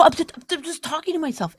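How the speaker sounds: a soft sheepish husband excuse; hushed mock excuse. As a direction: mumble an excuse under your breath. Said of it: "And you go".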